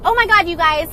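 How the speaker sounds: mock starstruck gushing; gushing fans. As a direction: swoon over him like an excited fan. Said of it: "And they're like".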